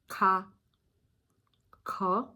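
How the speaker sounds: "ka" from the back of the tongue; crisp back "ka" syllables; velar ka demo. Shown as a demonstration. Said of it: "retract the tongue on the base".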